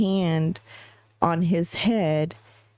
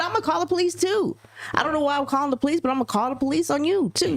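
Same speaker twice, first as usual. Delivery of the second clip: much higher, fast, wound-up; worked-up retort; 9 semitones higher, faster than usual. Retold or imitated. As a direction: fire it back in a frenzy. Heated threat in retold fight.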